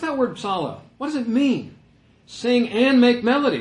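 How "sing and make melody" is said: "and" hit hard, high and loud; raised, louder voice with a punch on "and"; stress on "and". Shown as a demonstration. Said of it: "I'm emphasizing the word".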